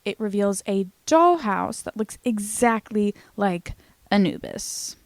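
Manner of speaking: ordinary delivery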